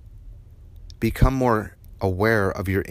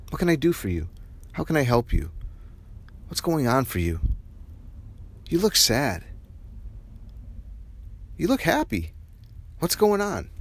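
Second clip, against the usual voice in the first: higher, gently concerned voice; caring questions; 5 semitones higher, 4 dB quieter than usual. Shown as a demonstration. Showing how to ask others, high and caring. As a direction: check in on someone, pitched up and tender.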